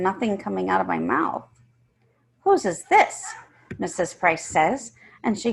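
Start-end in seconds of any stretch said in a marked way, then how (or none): 2.4-3.3 high, loud teacher's question; sharp teacher asking whose it is; stern demand. Acted. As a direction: demand an answer from the class.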